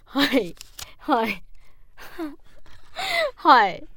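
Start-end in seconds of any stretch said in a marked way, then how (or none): 1.5-3.3 high squealing laugh. Real, not acted. Cracks up at cards.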